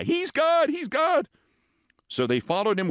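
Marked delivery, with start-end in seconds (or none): 0.0-1.3 very high chanted "He's God!"; crowd's cry. Acted. Creatures crying out in awe, high. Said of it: "the others took up the cry".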